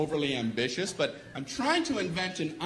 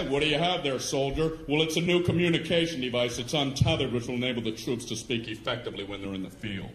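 general and soldier dialogue; 6 semitones lower than usual. Acted. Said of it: "It's a general talking to some guy".

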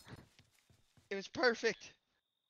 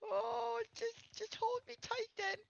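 mock crying plea; 10 semitones higher, 5 dB quieter than usual. Scared player begging, crying.

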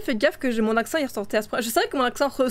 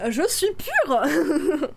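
mock innocence; 2 semitones higher, much slower than usual. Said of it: "Je ne dis jamais de gros mots".

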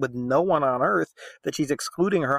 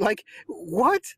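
mock disbelief; 7 semitones higher than usual. Real, not acted. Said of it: "laughs, right?".

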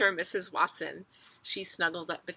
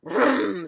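loud revving "vroom"; car engine noise; 3 semitones higher, 10 dB louder than usual. A narrator's reading. Father dreams of driving a fast car, revving engine noise. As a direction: rev it like a racing car. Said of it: "dreaming of driving a very fast car".

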